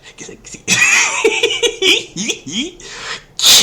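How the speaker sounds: breathless wheezing laugh; whispery laugh burst. Real, not acted. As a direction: laugh hard, almost silent.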